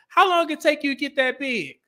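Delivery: put-on high-pitched boy's Southern accent; strong Southern accent. Little boy asking a big lifter, strong Southern accent. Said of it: "with a strong Southern accent".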